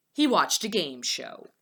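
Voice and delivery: ordinary delivery, nothing marked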